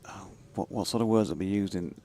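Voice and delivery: usual speaking voice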